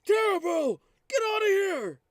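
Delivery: high, loud shouting in character; panicked shout. Acted. Player's character calls to flee, panicked high shout. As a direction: yell the alarm at full volume. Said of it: "I go".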